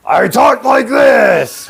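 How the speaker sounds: slow, strained high shout; drawn-out arena-announcer roar; put-on promo voice. Shown as a demonstration. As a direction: demonstrate an over-the-top announcer voice. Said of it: "I made my voice like".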